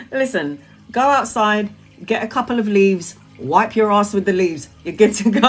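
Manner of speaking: ordinary talk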